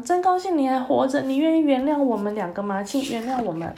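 mild glad shout. Acted. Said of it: "将军也大喊".

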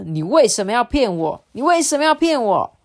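大声质问，第二遍音调猛地拔高; 越问越火大的责骂; 生气质问. A narrator's reading. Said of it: "很生气地问赵干说". (张弼对渔夫越来越生气地质问)

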